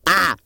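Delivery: loud, high sudden "ah!"; one sharp burst of a yell; playful outburst scream; short scream. Pretend. Screams in the closet.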